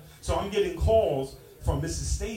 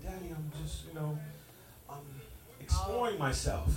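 put-on much softer, shy boy's mumble; timid teen son; 4 semitones lower, 9 dB quieter than usual. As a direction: mumble it like an embarrassed teenage son. Son voiced softly.

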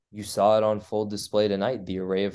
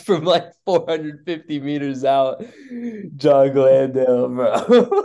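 laughing while talking; 7 semitones higher, 6 dB louder than usual. Real, not acted. Co-host cracking up mid-sentence.

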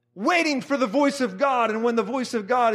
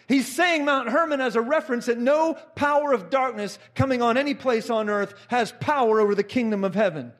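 ordinary talk, nothing marked

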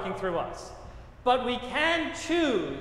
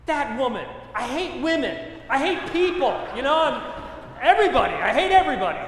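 building louder into a laughing mock rage; hammed-up rant; 4 semitones higher, 6 dB louder than usual. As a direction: exaggerate your petty fury for the crowd. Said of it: "I'm thinking".

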